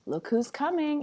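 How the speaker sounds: usual speaking voice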